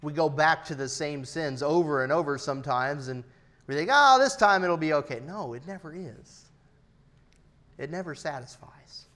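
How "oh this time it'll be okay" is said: high, loud mock optimism; exaggerated hopeful sinner, pitched up; mock self-assurance. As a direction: talk yourself into it, loudly. Mimics sinner fooling himself.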